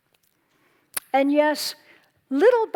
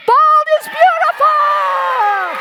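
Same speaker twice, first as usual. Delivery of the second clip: high, slow, loud rallying shout; proud shout; 12 semitones higher, 9 dB louder, much slower than usual. Real, not acted. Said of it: "share with you one more time".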